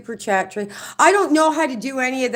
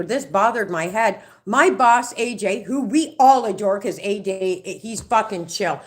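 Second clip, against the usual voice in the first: usual speaking voice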